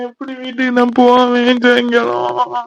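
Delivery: drawn-out tearful wail; crying. Acted. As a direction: wail it softly through tears. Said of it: "இவ தான் அழுதுட்டு இருக்கா". (அழுதுகொண்டே புலம்பல்)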